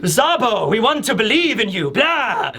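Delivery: light mock vampire plea; a hint of a vampire, ending in blah; Ivankov. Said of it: "sounding like a vampire".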